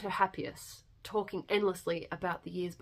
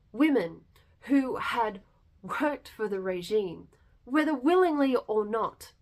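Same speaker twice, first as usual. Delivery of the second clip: rising from firm to a loud, higher, impassioned cry; building outrage; 3 semitones higher, 9 dB louder than usual. A narrator's reading. Narrator condemning the vengeance on French women, building to loud outrage. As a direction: let the anger swell line by line until it is almost shouted.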